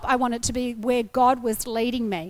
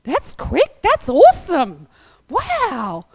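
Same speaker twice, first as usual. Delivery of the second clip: breathless whispered wow, then an excited burst; hushed amazement rising to a gleeful cry; thrilled gasp; 5 dB louder, much slower than usual. Retold or imitated. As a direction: gush with delight at the quick call-back. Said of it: "And I was like".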